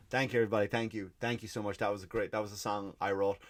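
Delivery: quiet mock thanks. Piper thanking crowd, mocked.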